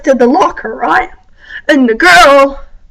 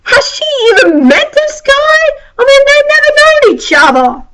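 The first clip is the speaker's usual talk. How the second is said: much higher, disbelieving question; incredulous; 11 semitones higher than usual. Retold or imitated. Vlogger doubts her friend's phone boyfriend, incredulous and high. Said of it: "when I thought to her".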